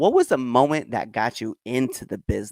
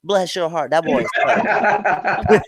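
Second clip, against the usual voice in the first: louder, pitched-up Southern drawl with laughter; Southern slur; 5 semitones higher, 4 dB louder, much slower than usual. Shown as a demonstration. Trading Southern cliches with friends, in Southern slur. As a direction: lay on the Southern accent, breaking into laughter. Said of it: "slur comes out so easy".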